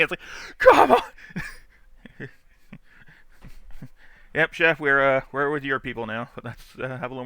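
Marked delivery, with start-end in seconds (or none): 0.6-1.0 high mock-exasperated come on; lightly voiced peeved driver; imitating angry driver. Angry driver yelling at him, mock exasperated. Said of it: "that guy was pissed at me".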